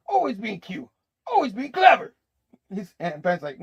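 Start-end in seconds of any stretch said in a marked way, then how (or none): 0.1-2.1 high, rising to loud; mock exasperated complaint. Jonah complains about kids these days, loudly exasperated. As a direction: grouse loudly as the fed-up boss.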